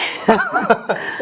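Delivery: bright burst of laughter; laughing. Real, not acted. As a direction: laugh out loud. Host laughs at pré-cougar.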